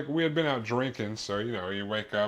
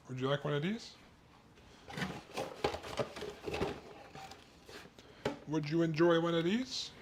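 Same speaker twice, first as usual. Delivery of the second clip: talking to the dog; 5 semitones higher, 7 dB quieter than usual. Real, not acted. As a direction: coo at the little dog with a treat.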